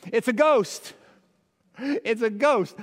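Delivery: hammed-up panicked sailor; playful fearful shout; mock terrified disciples. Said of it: "So what do they say?".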